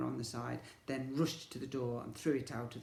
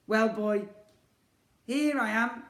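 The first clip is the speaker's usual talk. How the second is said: defiant; 9 semitones higher, 11 dB louder than usual. Acted. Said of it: "replied Ned defiantly".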